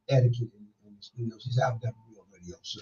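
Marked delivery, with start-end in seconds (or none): 1.6-2.8 low, hushed retelling of her line; imitating lowered voice. Guest recounting her studio boast, imitated low and quiet. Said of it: "did you hear her voice go down".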